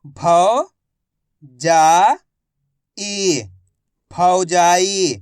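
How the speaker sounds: loud, slow, syllable-by-syllable reading drill; a teacher sounding out Hindi words letter by letter. Shown as a demonstration. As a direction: drill each letter aloud for the class. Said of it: "अब आगे देखिए".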